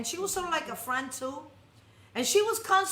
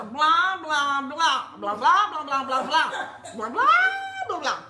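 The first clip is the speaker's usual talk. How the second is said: a hint of the Peanuts teacher droning; mock the muffled grown-up drone, lightly; 5 dB louder than usual. Mocking complainers as the Peanuts teacher, lightly droned. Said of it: "just like the Peanuts".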